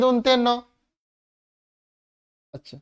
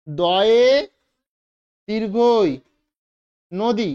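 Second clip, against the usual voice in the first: দয়ে, দীর্ঘই, নদী, each with a pause; teacher spelling out each part slowly; broken into parts; much slower than usual. Shown as a demonstration. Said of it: "এটা যখন আমরা ভাঙবো".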